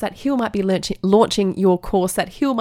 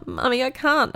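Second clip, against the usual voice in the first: put-on whiny child; 6 semitones higher than usual. Acts son giving up.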